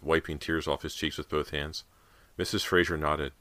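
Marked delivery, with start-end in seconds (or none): none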